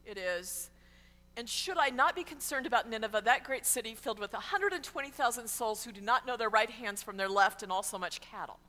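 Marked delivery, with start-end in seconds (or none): 1.3-8.6 rattled off fast without a break; run-on sentence. Acted. God questions Jonah about Nineveh, fast run-on delivery. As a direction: rush through it all in one long run. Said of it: "the greatest run-on sentence".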